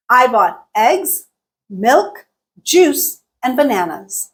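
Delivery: EGGS, MILK, JUICE each lifted, BANANAS dropping; rise-fall list intonation. Shown as a demonstration. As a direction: raise each item, pause, then fall on BANANAS. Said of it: "called a rise-fall intonation pattern".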